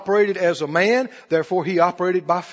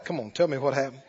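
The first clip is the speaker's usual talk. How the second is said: normal talking voice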